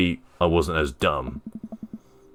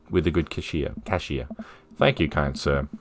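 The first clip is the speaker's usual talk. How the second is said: normal talking voice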